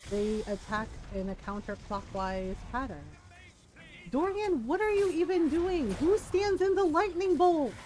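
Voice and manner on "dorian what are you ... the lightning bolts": exasperated scolding. Real, not acted. Scolding character in game.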